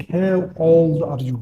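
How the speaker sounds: very slow, each word drawn out; slow demo. Shown as a demonstration. Teacher to students, modelling the question very slowly. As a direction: spell the question out slowly for learners. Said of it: "فواحد يقول لك".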